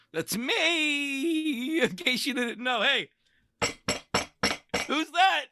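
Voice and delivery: high, drawn-out mock-triumphant cry; hammed-up boast. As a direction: sing out your own glory in a squeal. Hammed-up bragging about himself.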